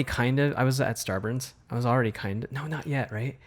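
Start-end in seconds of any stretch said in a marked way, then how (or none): none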